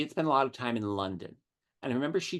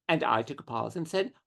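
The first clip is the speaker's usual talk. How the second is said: normal talking voice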